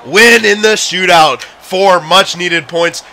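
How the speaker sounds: loud, high, laughing cheer; excited celebration. Real, not acted. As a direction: call the winning goal like an announcer. Celebrates the shootout win.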